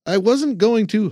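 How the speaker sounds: high, sulky trainee protest; young man voice. Acted. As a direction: whine it like a scolded recruit.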